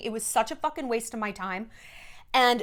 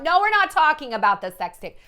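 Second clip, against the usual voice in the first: louder, higher mock refusal; publicist shutting it down; 4 semitones higher, 6 dB louder than usual. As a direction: cut them off like a protective publicist. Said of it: "the publicist of the celebrity is gonna just usher them on".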